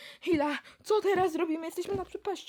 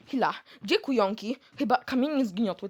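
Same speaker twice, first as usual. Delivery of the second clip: ordinary delivery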